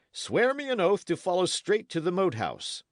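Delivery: high, forceful demand; commanding. Acted. Knight demanding an oath from the lad, forceful and commanding. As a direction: press hard for the oath.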